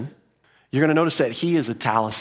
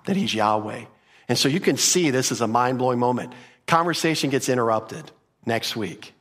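ordinary delivery

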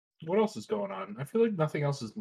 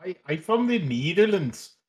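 hammed-up Dutch accent, sing-song tune; bad Dutch accent; 3 semitones higher than usual. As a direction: put on a silly Dutch accent.

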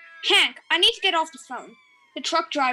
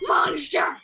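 outraged cry. Acted. Outraged at the mummy joke.

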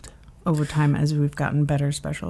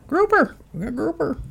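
hawking vendor; 9 semitones higher than usual. Retold or imitated. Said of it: "Everywhere I went in Florida".

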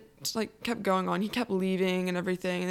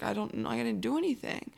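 mildly upset, higher-voiced self-defence; upset protest; 4 semitones higher than usual. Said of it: "I was just, like, upset".

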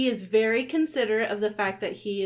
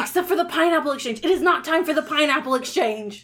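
Eddie's protest; 6 semitones higher, 5 dB louder, faster than usual. Acted.

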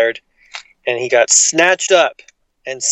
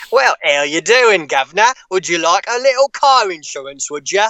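chirpy Cockney insurance pitch, a bit higher; cheerful Cockney salesman voice; Cockney gecko; 4 semitones higher than usual. Pretend. Friend wants voices, so a Cockney lizard selling car insurance. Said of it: "get you to do voices".

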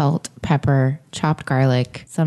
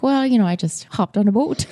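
higher-pitched, badly faked Irish accent; amateur Irish lilt, pitched up; 7 semitones higher than usual. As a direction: have a playful go at an Irish accent. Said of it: "I could just do the Ireland accent".

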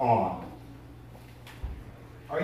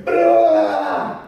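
loud, high imitated blast; mock explosion boom; explosion noise; 11 semitones higher, 9 dB louder than usual. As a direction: burst out with a shell going off. Shelling starts ten minutes later.

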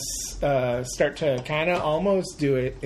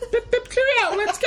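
very high, squeaky posh English cheer; mock British; 4 dB louder than usual. Host launches the movie talk in a squeaky mock British voice. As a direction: trill it like a cartoon English chap.

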